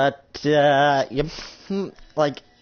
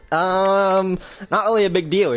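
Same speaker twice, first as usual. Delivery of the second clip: higher-pitched, breezy brush-off; nonchalant; 6 semitones higher than usual. Acted. Woman on phone after shooting a devil, nonchalant. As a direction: play down the danger.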